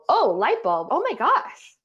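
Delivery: a partner's lightbulb moment, loud and bright with surprise; sudden realization. Retold or imitated. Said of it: "they go".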